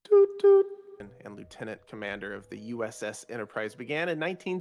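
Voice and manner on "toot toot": high loud horn toots. Pretend. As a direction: toot like a little train. Train horn imitation.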